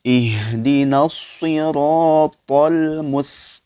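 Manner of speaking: Quran recitation. Acted. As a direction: chant the verse slowly like tilawah. Recites verse. (bacaan tilawah pelan dan lantang)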